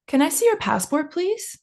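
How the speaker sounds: polite question, "passport, please?" rising at the end; rising question intonation. Shown as a demonstration. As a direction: voice climbs to a high rise on "please?". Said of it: "your intonation is going up at the end".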